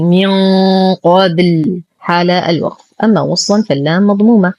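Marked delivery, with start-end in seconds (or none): 0.0-1.8 من with a long thickened hum on ن into قبل; raised heavy nasal. Shown as a demonstration. Teacher says how to recite it, heavy hummed ن in من قبل. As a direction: hold the ن of من, heavy, before قبل. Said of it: "نستعلي بالغنة فنقول".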